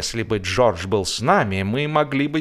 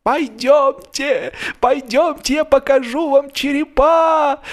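put-on high, drawn-out pleading old man calling after someone; plaintive calls; slower than usual. A narrator's reading. Old man pleading with the fleeing narrator to come back and see the skulls, plaintive high calls. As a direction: call after him pitifully, stretching each word. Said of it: "жалобные призывы".

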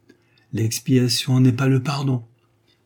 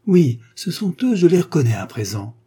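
excited recognition; 6 semitones higher, 6 dB louder than usual. Acted. Recognising the arriving travellers.

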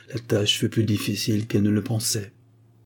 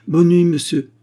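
louder, higher brusque dismissal; brusque goodnight in a raised, high voice; 8 semitones higher, 8 dB louder than usual. A narrator's reading. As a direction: send him off abruptly, voice raised. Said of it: "dit Agar d'un ton brusque".

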